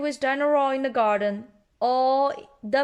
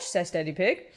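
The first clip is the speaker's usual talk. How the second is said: ordinary delivery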